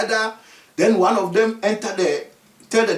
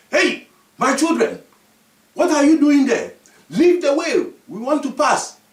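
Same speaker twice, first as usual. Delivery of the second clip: calling out; 5 semitones higher, 4 dB louder than usual. Acted. Chief calls out to the children.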